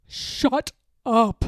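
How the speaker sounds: screamed. Acted. Toad cuts off the announcing voice, mock scream. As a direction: cut the voice off with a shout. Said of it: "screamed Toad".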